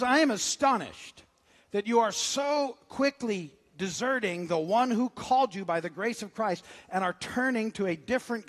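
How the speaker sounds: loud, earnest scripture read to the church. Acted. Pastor reading Galatians to the congregation, loud and earnest. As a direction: proclaim Paul's letter with fervor.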